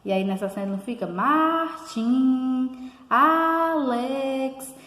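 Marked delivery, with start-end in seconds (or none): 1.1-2.9 "Martin" stretched long as if calling someone; sing-out call of "Martin", held and raised; calling out a name. Shown as a demonstration. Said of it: "como se tivesse chamando alguém". 3.1-4.8 "Alex" called out high, first syllable held long; drawn-out, raised hail on "Alex"; calling out a name. Shown as a demonstration. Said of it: "como se tivesse chamando alguém".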